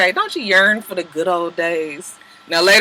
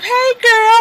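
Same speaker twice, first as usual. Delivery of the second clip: mock girly greeting; 14 semitones higher than usual. Greeting friend walking in.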